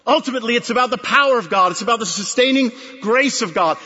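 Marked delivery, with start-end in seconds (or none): none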